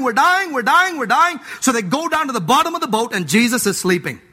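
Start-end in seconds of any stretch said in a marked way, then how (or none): 0.0-1.4 mock panic. Disciples crying out in the storm, mock panic. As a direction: ham up the disciples' terror. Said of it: "The boys are thinking they're dying".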